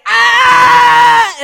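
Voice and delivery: loud, high, long mock scream; dramatized scream. Man whose ear was cut off, dramatized scream. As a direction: scream it out, hammed up for the audience. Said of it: "dramatizing it for you".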